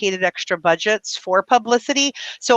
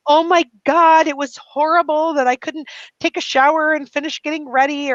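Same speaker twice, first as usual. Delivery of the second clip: mock melodrama; 6 semitones higher than usual. Mimicking people who complain about no shower, mock horrified. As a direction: ham up the drama of a small disaster. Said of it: "most people would go".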